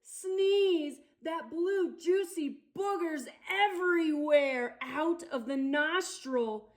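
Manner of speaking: ordinary delivery, nothing marked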